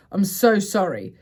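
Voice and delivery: normal talking voice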